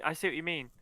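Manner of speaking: ordinary talk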